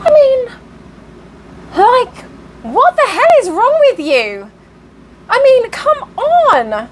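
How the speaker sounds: high-pitched, incredulous protest; exasperated outburst. Real, not acted. Storyteller reacts to the woman's nerve, exasperated. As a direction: let disbelief push the voice way up.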